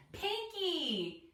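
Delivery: high, stretched name call; urgent answer. A narrator's reading. Penny tells Mia who is missing, high insistent answer.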